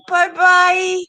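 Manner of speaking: normal talking voice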